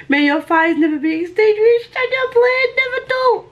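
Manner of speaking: high, gushing read of the inscription; thrilled reading. Real, not acted. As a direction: read it aloud, bursting with joy. Reads the inscription aloud.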